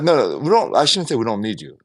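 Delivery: normal talking voice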